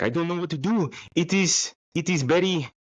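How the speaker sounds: high-pitched, badly faked foreign accent; Ivana Humpalot. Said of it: "I can't even do that accent".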